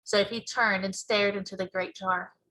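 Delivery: ordinary delivery, nothing marked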